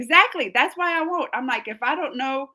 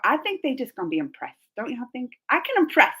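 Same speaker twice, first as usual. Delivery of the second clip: normal talking voice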